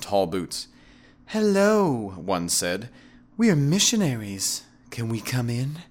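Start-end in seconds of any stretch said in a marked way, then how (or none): 1.3-2.1 high, drawn-out, flirty hello; flirty woman missionary. A narrator's reading. Woman missionary greets him seductively at door. 3.4-5.8 high feminine come-on; sweetly inviting, high voice; flirty woman missionary. A narrator's reading. Women missionaries flirt at the door.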